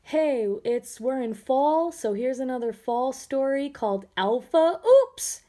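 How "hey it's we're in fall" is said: usual speaking voice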